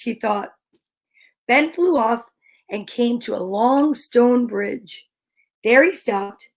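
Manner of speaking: ordinary delivery, nothing marked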